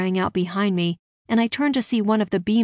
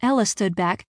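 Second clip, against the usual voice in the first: ordinary delivery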